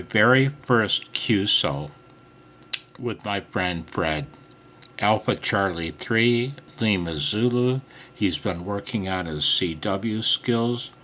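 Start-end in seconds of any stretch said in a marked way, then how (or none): none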